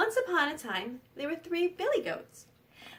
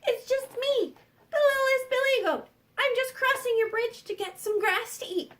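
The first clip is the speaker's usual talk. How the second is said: high-pitched little goat voice; littlest billy goat; 8 semitones higher than usual. Acted. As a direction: pipe up as the smallest, harmless goat. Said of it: "the little billy goat said".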